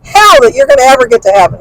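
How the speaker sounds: indignant put-down from a retold argument; retold angry comeback. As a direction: re-enact telling him off, exasperated. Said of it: "I was so mad at him".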